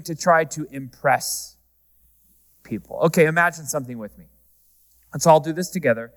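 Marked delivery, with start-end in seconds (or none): none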